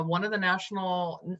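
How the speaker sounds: ordinary delivery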